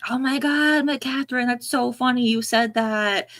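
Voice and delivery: high-pitched, delighted recognition; real amused excitement. Real, not acted. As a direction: react gleefully to a funny coincidence. Amused reaction to a comment.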